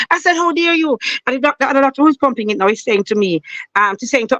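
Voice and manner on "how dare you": higher, pushed angry voice; retold angry retort. Grieving mother confronting the doctor, high angry retort. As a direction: throw her words back at her, high and sharp.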